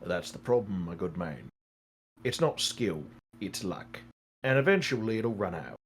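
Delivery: low, measured voice of counsel; concerned advisor Arno. Acted. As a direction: gently caution a foolish gambler.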